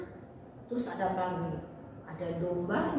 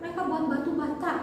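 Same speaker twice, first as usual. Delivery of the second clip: ordinary delivery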